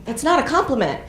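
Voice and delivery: rising to a loud, indignant challenge; mock indignation. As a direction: build from confusion to outrage. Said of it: "It's like".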